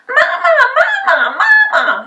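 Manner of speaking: put-on high, loud excited baby voice; a little dragon calling for mum. A narrator's reading. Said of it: "he called".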